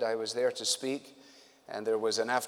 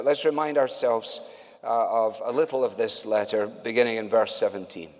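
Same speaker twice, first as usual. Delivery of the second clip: ordinary delivery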